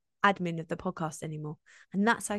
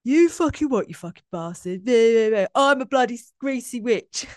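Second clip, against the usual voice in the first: loud, jeering inner-critic voice, much louder; higher, louder mocking critic; Clara's voice; 3 semitones higher, 9 dB louder than usual. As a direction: spit it out like a nasty heckler in your head. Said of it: "this is how Clara talks".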